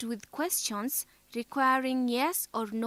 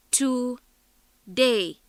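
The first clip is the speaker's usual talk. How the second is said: each syllable said separately and firmly, TO... DAY; 6 dB louder than usual. Shown as a demonstration. Teacher splits a word into syllables, syllable by syllable. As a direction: break TODAY into TO, DAY with a clear pause. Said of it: "two syllables".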